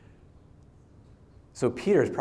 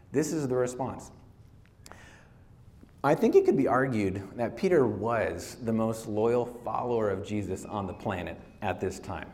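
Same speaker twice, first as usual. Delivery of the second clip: normal talking voice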